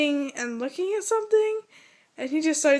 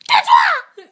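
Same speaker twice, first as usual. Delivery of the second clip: very high, loud, shrill mimicked shout; 11 dB louder than usual. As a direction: imitate a kid shrieking at his sister. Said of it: "really shrill".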